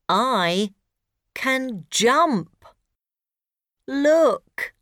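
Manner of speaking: high eager robot; proud robot. Acted. Robot speaks.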